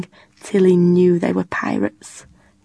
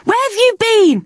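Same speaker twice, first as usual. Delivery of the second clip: high, loud call of relief; shouted; 14 semitones higher, 4 dB louder, faster than usual. A narrator's reading. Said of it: "she shouted".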